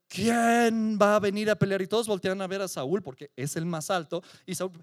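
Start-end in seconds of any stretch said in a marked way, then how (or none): 0.1-1.8 loud booming challenge to the army; giant's challenge. Retold or imitated. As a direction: call out to the whole valley like the giant. Goliath calls out a challenge. (desafío del gigante a gritos)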